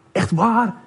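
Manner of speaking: high-pitched surprised question. Acted. Neus reacts to Hand's news.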